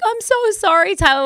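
put-on high, sing-song apology to a baby. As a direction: coo it like talking to a toddler. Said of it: "we were like".

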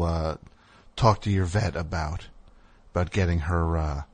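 low, soft, hesitant professional voice; tactful groomer. Acted. Groomer carefully advising the owner about the dog's weight, tactful. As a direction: break it gently, with careful ums. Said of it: "You wanna say something like that, you say".

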